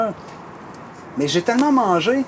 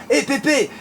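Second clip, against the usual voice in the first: calling out; 7 semitones higher than usual. Retold or imitated. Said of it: "Puis là, j'entends".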